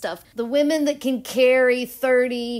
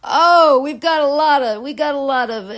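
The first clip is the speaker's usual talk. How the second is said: excited outburst; 5 semitones higher, 8 dB louder than usual. Real, not acted. Sees masculine planets in reading.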